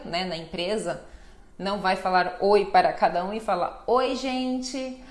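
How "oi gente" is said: ordinary talk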